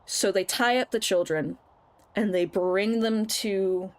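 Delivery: ordinary talk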